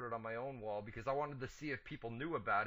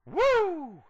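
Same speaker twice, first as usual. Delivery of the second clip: loud high woo; woo cheer; 16 dB louder than usual. Real, not acted. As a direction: whoop like a crowd. Hype cheer.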